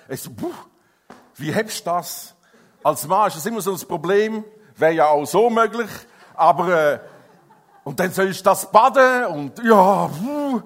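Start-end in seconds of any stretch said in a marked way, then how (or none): 2.8-10.6 louder, laughing, overwhelmed new father; flustered excitement. Real, not acted. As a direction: burst out with laughing helplessness. Recalling bathing his newborn.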